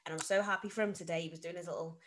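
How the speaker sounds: ordinary talk, nothing marked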